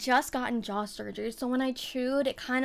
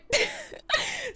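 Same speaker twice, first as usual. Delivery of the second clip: a squealing laugh at herself; laughing at her own fear of a drink; high burst of laughter. Real, not acted. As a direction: crack up high at the memory.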